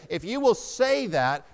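usual speaking voice

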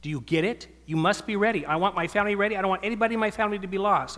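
put-on a small girl insisting at the dinner table; little daughter preaching. Father voicing his daughter urging the family at dinner, in a high urgent child voice. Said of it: "she says".

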